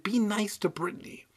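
soft, high, pleading whisper. Acted. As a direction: beg the dummy under your breath. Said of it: "Ethan pleaded".

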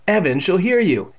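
urgent objection, voice lifted; protesting. A narrator's reading. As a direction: protest in alarm. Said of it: "Andy protested".

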